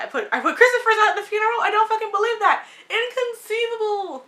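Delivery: can't believe it, laughing it out; real giddy shock at the funeral scene; laughing disbelief. Real, not acted. Vlogger reacting to shocking scene.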